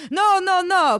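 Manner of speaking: high, loud, insistent refusals; hammed-up imperious lover; commanding. A narrator's reading. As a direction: command it with operatic force. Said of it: "commanded Ivan".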